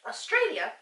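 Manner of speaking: high loud proclamation of "Australia"; grand announcement. Acted. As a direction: announce "Australia" like a big reveal. Proving a point, announcing.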